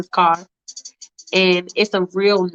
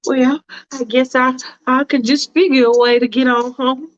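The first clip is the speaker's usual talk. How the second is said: higher, prim and hesitant; a fussy woman hedging politely; Faith's fussy voice; 5 semitones higher than usual. Acted. Faith hesitating to the driver, prim and higher.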